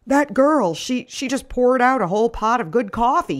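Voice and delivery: the father-in-law fuming over his dumped coffee; all worked up. Acted. Father-in-law complaining over the dumped coffee, all worked up. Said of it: "he says, all worked up".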